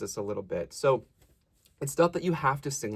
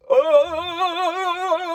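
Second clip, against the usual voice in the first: loud shaken ah; 8 dB louder than usual. Shown as a demonstration. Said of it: "shake your body".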